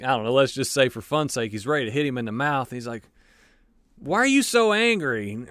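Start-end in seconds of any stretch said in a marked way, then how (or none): 4.0-5.5 a high, puzzled challenge, lightly hammed-up; mock baffled brawler. One mob guy asks another why he's so angry, mock baffled. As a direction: poke at him, half-joking, wanting to know why. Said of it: "and he's like".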